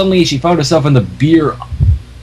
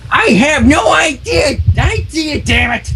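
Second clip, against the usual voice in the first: put-on high, slow shriek of an old crone; shrieking old mare; 10 semitones higher, slower than usual. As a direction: screech it in outrage. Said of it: "the old pony shrieked".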